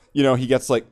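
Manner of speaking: ordinary delivery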